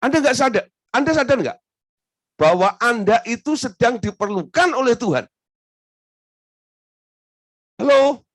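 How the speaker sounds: high, loud calling out to the crowd; preaching at full force. Acted.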